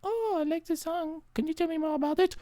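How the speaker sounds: high, soft, quick asking; hammed-up eager fan; high eager stranger. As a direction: lean in curious. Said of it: "the person's like".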